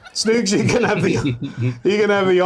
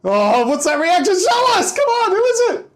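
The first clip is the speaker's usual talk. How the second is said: excited shouting; 12 semitones higher than usual. Real, not acted. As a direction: yell at them to show it. Host begging to see winner.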